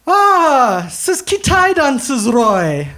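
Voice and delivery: high, loud and excited, drawn out; suddenly thrilled, it all makes sense; delighted driver. Retold or imitated. Taxi driver's delighted realization.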